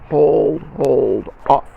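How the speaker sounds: loud, stretched "Hold" with a cut on "off"; loud held count. Shown as a demonstration. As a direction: call out the long note in rhythm. Counting out a held final note.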